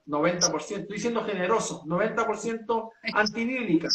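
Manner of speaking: normal talking voice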